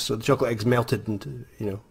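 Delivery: normal talking voice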